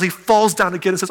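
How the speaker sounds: normal talking voice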